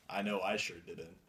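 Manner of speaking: ordinary talk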